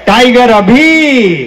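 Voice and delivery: high, drawn-out rallying cry; slow, high crowd-rousing call; slogan call. Real, not acted. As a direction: raise the pitch and stretch out the slogan. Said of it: "दोनों हाथ उठा के एक बार बोलो".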